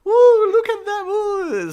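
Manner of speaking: high, loud teasing coo; mock cooing onlookers. Onlookers teasing the kissing girls, high loud cooing. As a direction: coo at the kissing couple like a giddy crowd. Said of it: "They're like".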